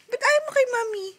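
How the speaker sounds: high, loud playful whine; mock complaint. Real, not acted. Mother asking why baby rejects her, mock indignant.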